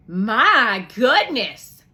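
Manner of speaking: slow, loud amazement; amazed. Acted. Missus Bailey opening the money box, slow and amazed. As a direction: draw it out in wonder.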